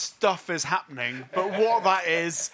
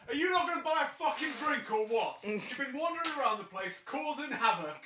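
high, fast, furious shout from across the bar; angry barkeep yell; 9 semitones higher, 6 dB quieter, faster than usual. Acted. Barkeep shouting at the party to buy a drink, fast and angry. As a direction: rant at the troublemakers, much higher. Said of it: "you hear from the direction of the bar".

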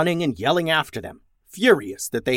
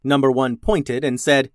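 plain reading voice

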